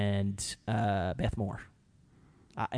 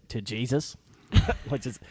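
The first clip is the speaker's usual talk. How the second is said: ordinary talk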